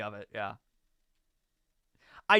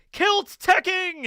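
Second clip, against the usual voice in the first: excited; 9 dB louder, much slower than usual. Real, not acted. Showing off the kilt gear.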